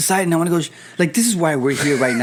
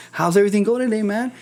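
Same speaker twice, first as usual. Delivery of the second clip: mock-friendly salesman, pitched up; chatty salesman; 8 semitones higher than usual. Evangelist keeps chatting up a stranger, mock salesman. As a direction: keep the small talk rolling like a pitchman.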